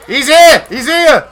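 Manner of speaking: high, loud alarmed yell; alarmed shout. Real, not acted. Enemy spotted in battle.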